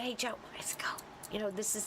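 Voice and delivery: whispered urgently, almost no voice; retold whisper. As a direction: whisper to him so nobody else hears. Sneaking up on Joe in dark field.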